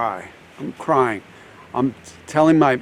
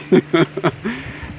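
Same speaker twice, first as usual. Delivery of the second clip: short rising giggle, higher; real laugh; 5 semitones higher than usual. Real, not acted. Therapist amused at her tiny tear from laughing, giggle. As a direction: chuckle at your own failed crying. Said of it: "from laughing".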